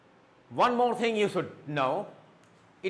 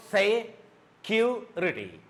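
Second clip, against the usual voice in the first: security slowly split into se, cu, rity; security in separate beats with pauses; syllable by syllable; 3 semitones higher, much slower than usual. Shown as a demonstration. Teacher breaking security into three syllables, syllable by syllable. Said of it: "it has got three syllables".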